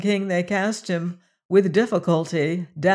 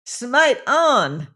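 higher, loud screamed order; screaming command; 6 semitones higher, 4 dB louder than usual. Acted. Nam screaming at the priests to kill, high and loud. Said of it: "screamed Nam".

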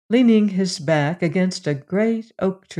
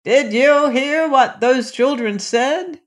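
slow, high, shouted question; raised, drawn-out calling voice; shouting; 7 semitones higher than usual. A narrator's reading. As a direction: call it out slowly to someone hard of hearing. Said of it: "he shouted".

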